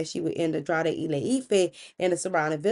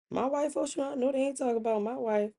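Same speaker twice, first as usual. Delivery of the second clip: hushed, higher-pitched incredulity; disbelieving; 6 semitones higher, 7 dB quieter than usual. Acted. Husband hears his wife is the miracle worker, disbelieving. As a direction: question it softly, as if to yourself.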